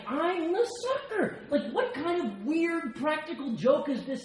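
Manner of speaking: a laughing squeak of disbelief; stunned self as newcomer. Retold or imitated. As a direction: play your baffled past self, high and amused. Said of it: "I'm like".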